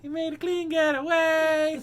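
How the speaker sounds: high, loud goofy voice; punchline voice. Pretend. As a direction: sell the pun hard. Pun delivered in funny voice.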